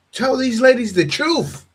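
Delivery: rising pitch as he calls the ladies out; emphatic call-out. Real, not acted. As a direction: tell it straight to the ladies. Preaching at the ladies.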